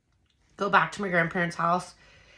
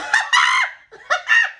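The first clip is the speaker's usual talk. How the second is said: very high, loud whooping laugh; 6 dB louder than usual. Real, not acted. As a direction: burst out in a shrieking laugh. Excited outburst mid-story.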